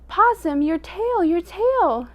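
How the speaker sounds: high, loud alarmed cry; screaming animals. A narrator's reading. Animals warning Possum his tail burns, loud high scream. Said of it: "and they screamed".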